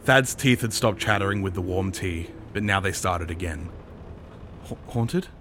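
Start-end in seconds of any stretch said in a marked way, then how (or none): 4.7-5.3 higher, stammered question; frightened gasp. A narrator's reading. Thad hearing of the haunting, gasping. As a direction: catch on the word, startled. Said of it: "he managed to gasp".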